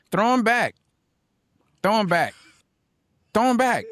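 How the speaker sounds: high, punchy repeated command; emphatic urging. Real, not acted. Host answering how to handle a parent's insults, emphatic urging. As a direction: fire it out high, a pause after each.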